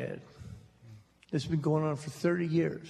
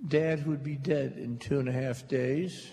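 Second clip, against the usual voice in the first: ordinary delivery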